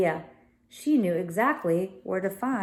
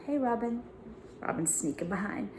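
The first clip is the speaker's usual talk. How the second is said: usual speaking voice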